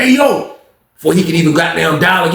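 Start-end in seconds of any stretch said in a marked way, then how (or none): 0.0-0.6 a much higher "hey, yo!" thrown across the room; calling out. Retold or imitated. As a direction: call him over from a distance. Jerome calls out across the pod.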